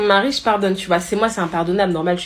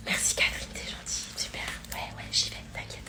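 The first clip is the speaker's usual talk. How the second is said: very soft whisper; whispered thanks; 16 dB quieter than usual. Acted. Teacher discreetly thanking a colleague on leaving, whispered.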